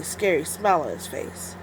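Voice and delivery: plain reading voice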